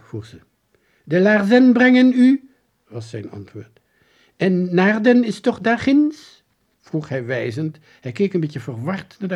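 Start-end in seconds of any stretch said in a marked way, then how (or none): 1.0-2.5 high, loud herald voice; strange soldier. A narrator's reading. 4.3-6.4 high, loud, slow question; ceremonious, drawn-out query; strange soldier. A narrator's reading.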